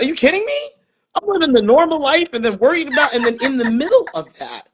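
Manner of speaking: high, animated mock disbelief; incredulous. Acts out astonished mother.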